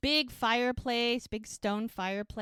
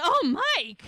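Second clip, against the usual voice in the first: high, loud startled cry; shocked exclamation; 9 semitones higher, 9 dB louder than usual. Retold or imitated. Amelia caught by Mike, high startled exclamation.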